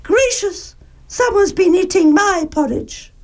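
high-pitched, startled mama bear; fluting, surprised storybook mother; Mother Bear's medium-sized voice. A narrator's reading. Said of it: "very medium-sized voice".

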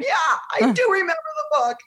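very high, half-crying shout; hammed-up exasperated yell back at heaven; mock wailing outburst.

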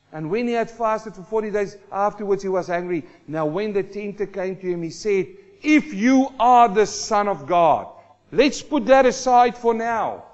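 loud, driving sermon delivery; full-force preaching. Acted. Preacher to his congregation, preaching loud and driving.

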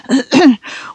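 short dry throat-clearing cough; dry little cough. A narrator's reading. Lawyer clearing his throat to begin, dry cough. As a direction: clear the throat drily before speaking. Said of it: "gave the dry little cough".

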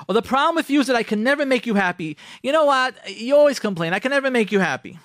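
high, worked-up accusation; complaining wife. Acted. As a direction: play a fed-up spouse's grievance.

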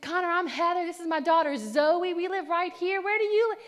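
high, loud, chatty friendliness; enthusiastic introduction. Retold or imitated. Woman introducing herself and daughter to Connor, brightly friendly. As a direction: imitate an eager getting-to-know-you chat.

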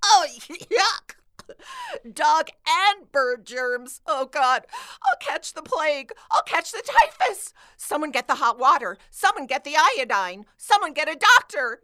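rising melodramatic alarm; over-the-top germ panic; hammed-up panic. Panicking over germy pie.

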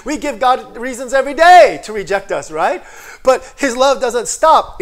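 loud, high, driving preacher voice; full-force preaching. Acted. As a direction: preach it out to the congregation. Preaching builds to a climax.

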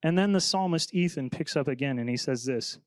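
ordinary delivery

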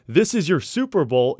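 ordinary delivery

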